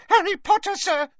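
very high, loud excited squeak; Dobby squeaking. A narrator's reading. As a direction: squeak it out loudly like an eager little house-elf. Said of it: "squeaked Dobby".

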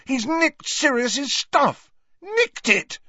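high, strained angry yell; indignant shouting, drawn out; outraged yelling. A narrator's reading. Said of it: "There's no point yelling".

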